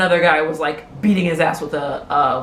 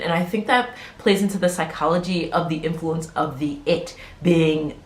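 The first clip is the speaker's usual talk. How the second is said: ordinary delivery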